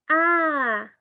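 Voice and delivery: loud high exclaimed "ah"; meaningful baby vocalization. Shown as a demonstration. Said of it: "la vocalización puede ser".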